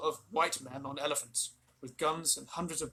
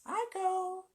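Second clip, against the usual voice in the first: quiet. Acted. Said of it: "said Tabaki quietly".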